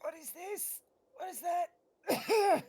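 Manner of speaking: slow, strained voice from the burn; strained after spice. Pretend. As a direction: choke out the question slowly. Character tasting spicy pepper.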